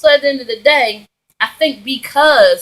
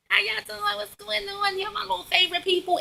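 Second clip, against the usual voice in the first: sweet high greeting; 11 semitones higher, 12 dB quieter than usual. Real, not acted. Greeting viewers, high soft.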